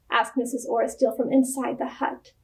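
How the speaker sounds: usual speaking voice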